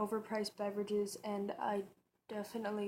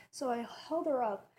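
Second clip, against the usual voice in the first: normal talking voice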